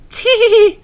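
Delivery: high squeaky giggle; tittering coaches. A narrator's reading. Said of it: "tittered the coaches".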